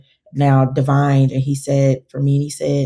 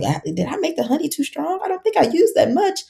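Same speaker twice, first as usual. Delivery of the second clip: playing her own startled self, much higher; worried surprise; 13 semitones higher than usual. She doubts her own strong honey, voice jumping high in mock alarm. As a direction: pitch jumps up high, half-joking alarm.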